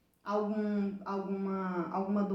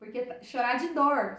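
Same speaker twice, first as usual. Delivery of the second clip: ordinary talk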